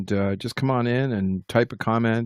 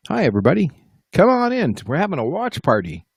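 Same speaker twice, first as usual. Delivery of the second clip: excited greeting; 7 semitones higher, 6 dB louder, faster than usual. Real, not acted.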